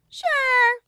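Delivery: very high, loud scoffing bear; dismissive. A narrator's reading. Monokuma brushing off Shuichi, high and dismissive. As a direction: brush him off, unconvinced. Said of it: "waved him off dismissively".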